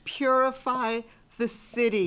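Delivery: loud plea. Acted. Chorus crying out to Dionysus to purify the city. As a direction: cry out to the god.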